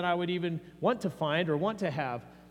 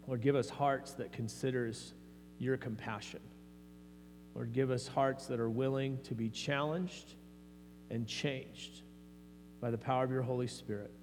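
softer, lower praying voice with long pauses; prayer; 5 semitones lower, 7 dB quieter than usual. Real, not acted. Pastor leading the congregation before reading the parables, hushed prayer. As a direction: pray gently and unhurried, pausing between phrases.

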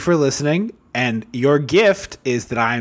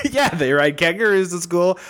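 laughing while talking; 3 semitones higher than usual. Real, not acted. Laughing along with a joke.

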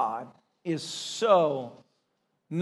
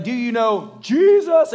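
louder, slower, searching question; slow, loud and higher Do you know Jesus; intense plea; 4 semitones higher, 7 dB louder, slower than usual. Retold or imitated. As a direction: ask it with the weight of the moment. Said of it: "he's like".

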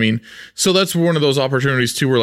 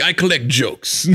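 lightly voiced Cyclops; 3 semitones higher, slower than usual. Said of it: "Cyclops voice".

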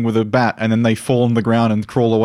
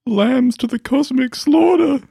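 menacing villain voice; 12 semitones higher, slower than usual. Acted. John introduced, villainous intro line.

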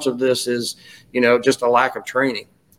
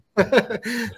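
light laugh; 9 semitones higher, 4 dB quieter than usual. Real, not acted. Laughing at the tease.

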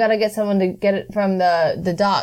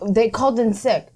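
usual speaking voice

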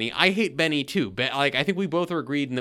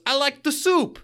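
mock Benny quote; 12 semitones higher, 5 dB louder than usual. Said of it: "And he goes".

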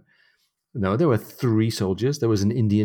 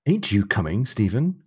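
usual speaking voice

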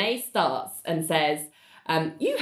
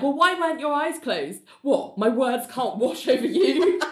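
high, indignant mock whine; mock-offended high whine; petulant John; 6 semitones higher than usual. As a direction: act wounded that nobody listened. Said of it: "And John's like".

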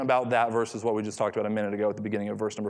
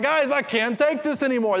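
much higher, louder mock-desperate whine; voicing the ship; 11 semitones higher, 4 dB louder than usual. Pastor voicing the storm-tossed ship for laughs, high mock panic. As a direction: play the ship as if it is about to crack. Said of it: "the ship is like".

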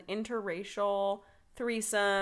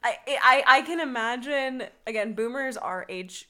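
ordinary talk, nothing marked

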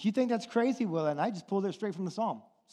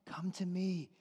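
gentle voice of God; 7 dB quieter than usual. Retold or imitated. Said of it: "and God is saying".

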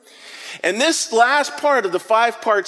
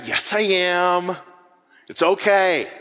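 1 reassuring parent; slower than usual. Retold or imitated. Parent catching child at pool.

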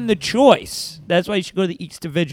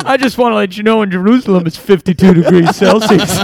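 louder, chirpy in-flight captain voice; airline captain announcement; 5 dB louder than usual. Pretend. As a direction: play the pilot greeting passengers. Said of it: "this is Pontius Pilate".

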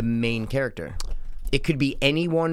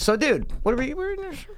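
high silly voice; 13 semitones higher than usual. Acted.

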